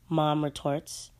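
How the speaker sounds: ordinary delivery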